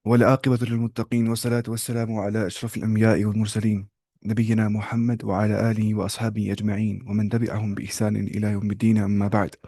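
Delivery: measured, formal Arabic opening praise; opening khutbah recitation. Acted. Teacher opens the class with Arabic praise, in a formal intoning style. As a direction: intone it like the start of a sermon.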